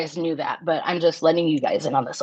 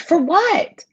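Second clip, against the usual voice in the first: high incredulous For what; 10 semitones higher than usual. Real, not acted. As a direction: ask it in high disbelief. Incredulous repeat.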